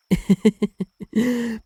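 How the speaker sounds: bright high burst of giggling; giggle. Real, not acted. Wife laughs recalling the carnival date memory, giggling. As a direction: let a fond laugh bubble up.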